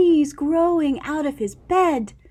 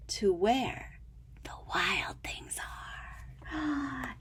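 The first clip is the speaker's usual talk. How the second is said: slow, much softer whisper; suspense whisper; 14 dB quieter, slower than usual. A narrator's reading. Reading the big reveal to a child, a slow whisper. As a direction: build suspense in a hush.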